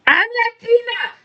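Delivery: high mock shout; mock scream. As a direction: yell it like a wrestler. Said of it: "she screamed out".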